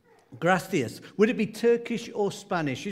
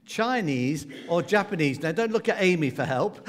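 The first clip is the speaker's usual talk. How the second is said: normal talking voice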